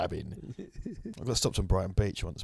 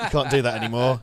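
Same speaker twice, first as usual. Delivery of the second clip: ordinary delivery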